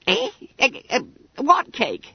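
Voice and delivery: high, squeaky fat schoolboy; Bunter voice. A narrator's reading. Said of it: "asked Bunter".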